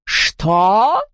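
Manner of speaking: drawn-out, rising villainous "what"; indignant ghostly yelp; outraged screech. A narrator's reading. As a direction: swoop upward in outrage. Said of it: "взвизгнула Яспро".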